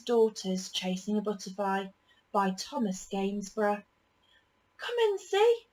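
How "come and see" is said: put-on high, eager child voice; little sister. A narrator's reading. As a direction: eagerly invite them in. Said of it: "said the girl's sister".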